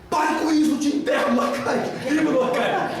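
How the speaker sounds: high, firm comeback with a laugh; mock patient comeback. Patient firing the therapist's stop it back at him, high. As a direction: throw his own order back at him.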